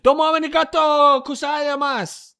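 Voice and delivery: jokey anime-style Japanese; mock Japanese. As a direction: toss off a hammed-up Japanese thanks. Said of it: "There, there's some anime for you".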